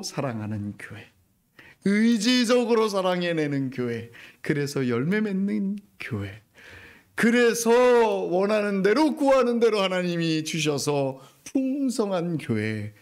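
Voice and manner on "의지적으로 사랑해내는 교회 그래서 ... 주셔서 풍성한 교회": loud, rising list building to the top; preaching climax. Acted. Pastor casting a vision for the church, loud and rising. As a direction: build each phrase with fervour.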